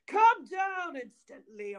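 a restrained bellow up the stairs; bawled order. A narrator's reading. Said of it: "cried out as loud as he could bawl".

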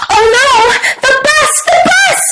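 high-pitched alarmed frog voice; panicked cry. A narrator's reading. Froggy sees the bus without him, panicked cry. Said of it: "he cried".

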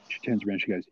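normal talking voice

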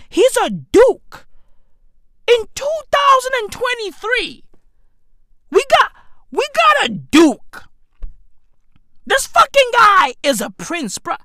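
can't believe this is real; incredulous. Real, not acted. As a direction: rant in high-pitched amazement. Incredulous rant about royalty.

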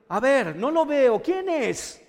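Jesus asking who calls. Acted. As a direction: call out searching for the voice.

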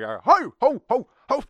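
high, loud hollering "ho, ho, ho"; mock shout "ho ho ho". A guard hollering at a runaway cow, high loud call. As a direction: holler it like you're chasing livestock.

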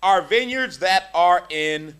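slow, loud, emphatic reading; a preacher reading Song of Songs slowly to the congregation; scripture reading. Real, not acted. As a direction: proclaim it like scripture. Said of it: "verse fifteen says".

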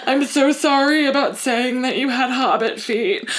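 hammed-up earnest confession edging into laughter; mock-urgent apology. Apologizing to a hurt friend, in comically overwrought earnest.

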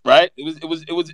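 normal talking voice

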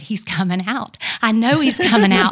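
rising into a loud, fervent shout; loud faith declaration. Real, not acted. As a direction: proclaim it with growing fervor. Praising God as nurses rush in.

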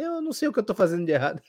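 lightly imitated, high and hurried helpless plea; quick, high mock bewilderment; baffled cartoon tiger. Imitating the tiger failing to calm a crying child, mock helplessness. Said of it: "aquele da Era do Gelo que a criança fica chorando e o tigre".

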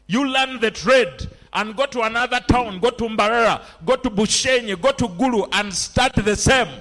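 loud, high, rousing sermon delivery; rally the congregation with punched phrases; full-force preaching. Acted. Preacher rousing believers to start out on their own, full-force preaching.

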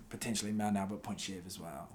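ordinary talk, nothing marked

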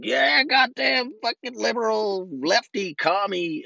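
high, slow mocking parrot voice; mocking a sheep. Mocking a political sheep, high and slow. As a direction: sneer it like a brainless follower. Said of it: "becoming a sheep and just being like".

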